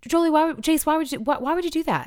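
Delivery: high, exasperated nagging parent; exasperated parent scolding. Retold or imitated. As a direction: scold the kids as if you can't believe it. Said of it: "it's so easy to be like".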